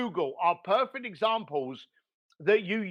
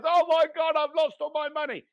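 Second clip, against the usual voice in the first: higher, louder mock self-pitying wail; mock pity party wail; 8 semitones higher, 3 dB louder than usual. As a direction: ham up a whiny loser mourning his money. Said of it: "throws a pity party and goes".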